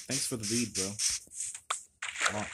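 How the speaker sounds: usual speaking voice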